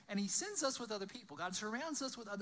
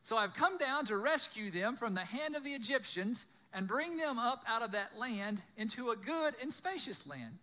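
loud, higher-pitched storytelling voice; dramatic scripture reading; 6 semitones higher, 6 dB louder than usual. A narrator's reading. Preacher reading the burning bush story aloud, loud and dramatic. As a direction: read the Bible story out to a crowd.

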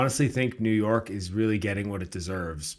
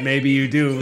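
loud, higher-pitched quote; imitating a movie line; 5 semitones higher, 9 dB louder than usual. Host echoes the line they waited for, hammed-up imitation. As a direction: throw out the line like the film character. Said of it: "That big cackle hold".

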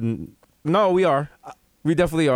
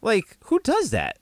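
snooty disbelief; 6 semitones higher, slower than usual. Pretend. Rich persona complaint.

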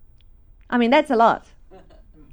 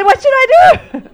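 higher, louder, laughing through it; laughing question; 12 semitones higher, 8 dB louder than usual. Retold or imitated. Speaker joking to the audience about hungry travel, laughing. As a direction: toss it off with a laugh.

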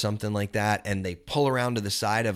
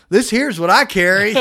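much louder, higher hillbilly boast; proud country boast; 8 semitones higher, 11 dB louder than usual. Retold or imitated. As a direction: show off the shotgun with a grin. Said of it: "And he goes".

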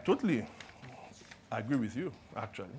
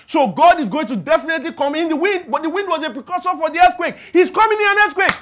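building preaching climax; 8 semitones higher, 11 dB louder, faster than usual. Acted.

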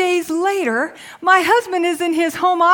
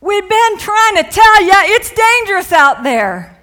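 mock exasperated shout; 2 semitones higher, 7 dB louder than usual. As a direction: shout it like you warned them all along. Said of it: "I can just see the disciples".

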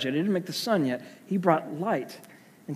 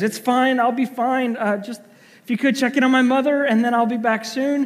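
higher and louder, brisk reassurance; wife's voice; 9 semitones higher, 5 dB louder than usual. Retold or imitated. Wife telling her husband she'll be fine, high brisk voice. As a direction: brush it off and move on.

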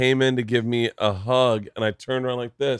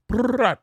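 mock gunfire; 11 semitones higher than usual. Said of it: "They're like".

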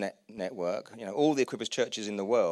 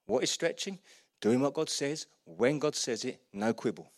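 normal talking voice

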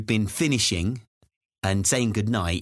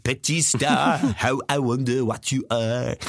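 slow, hammed-up French accent; slower than usual. Parent saying goodnight with Twinkle Twinkle, mock French accent. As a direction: croon the nursery rhyme in mock French. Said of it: "doing it in a French accent".

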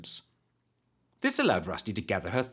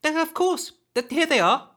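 put-on high nervous girl; 5 dB louder than usual. Scared girl hands over sweets.

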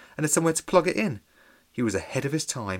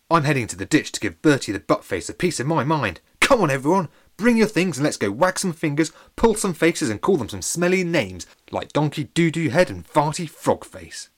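louder, rousing call to the crowd; rallying leader; 3 semitones higher, 6 dB louder than usual. Acted. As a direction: rouse them to march. Trevor rallies crowd.

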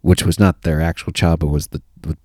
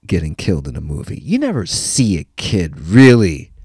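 ordinary delivery

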